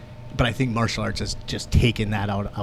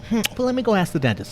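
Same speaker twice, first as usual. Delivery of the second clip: lightly voiced higher receptionist, quick; a quick, higher put-on for the receptionist; mock receptionist; 9 semitones higher, faster than usual. Receptionist stalling the patient, lightly voiced higher and quick.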